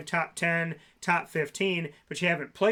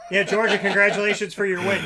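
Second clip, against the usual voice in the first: loud, higher sarcastic congratulations; mock congratulations; 4 semitones higher, 8 dB louder than usual. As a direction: announce a backhanded congratulations loudly. Joking jab at Georgia's demotion.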